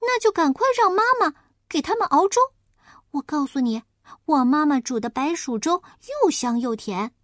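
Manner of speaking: high, quick, eager little pig; a helpful piglet with an idea. Acted. Piglet eagerly advises the wolf, high and fast.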